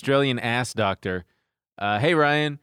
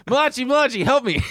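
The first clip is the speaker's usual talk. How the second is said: high, loud desperate gamer plea; panicked call for help; 11 semitones higher, 4 dB louder, faster than usual. Retold or imitated. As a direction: beg your teammate for help. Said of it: "I just was going".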